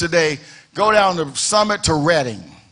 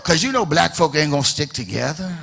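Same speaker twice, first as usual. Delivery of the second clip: low, softer mock voice of a doubting church leader; 5 semitones lower, 5 dB quieter than usual. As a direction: drop into a defeatist insider's mutter. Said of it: "This is what they say about you".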